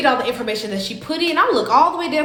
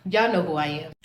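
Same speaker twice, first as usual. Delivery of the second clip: sarcastic; 8 semitones lower, 5 dB quieter than usual. Retold or imitated. Repeating the jab to the clerk, softer and low. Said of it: "So I'm being sarcastic".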